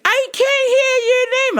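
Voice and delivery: put-on old lady caller. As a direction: quaver it out like a frail old lady.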